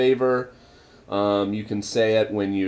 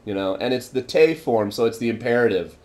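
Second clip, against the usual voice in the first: ordinary delivery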